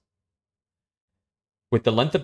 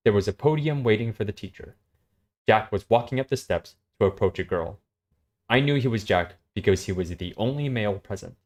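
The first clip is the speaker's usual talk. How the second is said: steady story reading, level and even; story narration; 8 semitones higher, 3 dB louder than usual. Acted. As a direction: read the scene out to a friend. S0 reads a story aloud.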